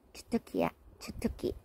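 whispered under the breath; whispering. Real, not acted. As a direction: breathe the words softly. Whispering to cat.

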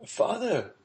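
high, excited call to father; crying out. A narrator's reading. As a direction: burst in calling for him. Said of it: "cried Maximilian".

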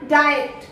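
"डाइट" said as DAA-yet; two syllables in "डाइट", ending "yet"; correct pronunciation. Shown as a demonstration. Teacher confirming for learners, correct pronunciation. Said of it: "इसे बोलते हैं".

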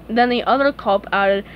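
plain reading voice

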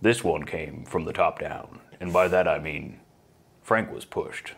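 low-key, soft podcast narration; imitating a super-calm, growly host; calm growly narrator. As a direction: narrate with no emotion, cool and collected. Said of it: "calm, collected about that".

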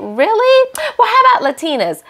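very high-pitched mocking imitation of her; mock disbelief. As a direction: squeal it back incredulously, imitating her. Acting out her retort to him.

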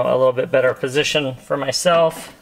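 normal talking voice

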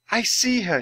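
ordinary delivery, nothing marked

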